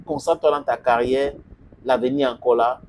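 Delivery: usual speaking voice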